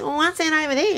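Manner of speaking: very high mock wife voice; put-on falsetto-like feminine asking; wife's voice. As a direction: squeak out a curious question as the wife. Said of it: "She goes".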